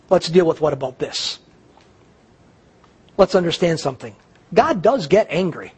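ordinary talk, nothing marked

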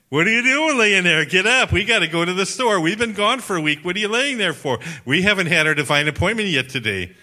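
high, loud nagging at a tired husband; pestering wife. Retold or imitated. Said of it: "my wife started pestering me".

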